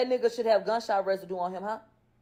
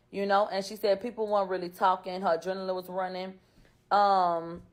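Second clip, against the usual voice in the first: ordinary delivery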